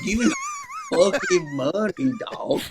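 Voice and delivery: very high, strained, drawn-out demand; hammed-up wailing robbery demand; mock robber. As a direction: act like a desperate stick-up man, slowly. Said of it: "now I sound like I'm robbing this place".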